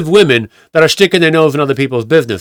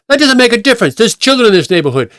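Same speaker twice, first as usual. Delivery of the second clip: high-pitched mock scolding; indignant retort; 9 semitones higher, faster than usual. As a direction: imitate an insistent busybody arguing back. Busybody argues back at the driver.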